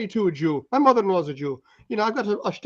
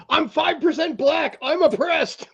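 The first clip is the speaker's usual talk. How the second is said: high, slow mocking whine; mock the self-pitying claim; high mocking voice, slow and whiny; mocking quote; 10 semitones higher, much slower than usual. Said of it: "It's like".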